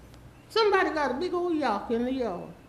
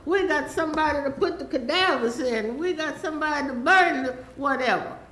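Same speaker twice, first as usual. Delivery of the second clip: normal talking voice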